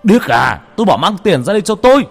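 loud, high, fast shouted order; furious roar. A narrator's reading. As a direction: roar the order into her face. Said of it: "mà gầm lên". (gầm lên giận dữ)

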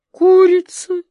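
high, loud cry of amazement; astonished. A narrator's reading. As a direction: blurt it out way up high, stunned. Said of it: "Одноглазик с изумлением заморгал".

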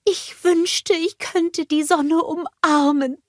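blissful snowman; Olaf's voice. A narrator's reading. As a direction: savour the best day ever. Olaf voiced in story.

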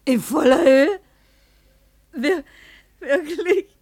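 can't believe the good news, voice breaking; overwhelmed elation, half crying; stunned disbelief. Acted. Hears she won in full.

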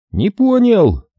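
loud, high bewildered exclamation; baffled shout. A narrator's reading. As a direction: blurt it out confused. Глебыч растерянно переспрашивает. (растерянный возглас)

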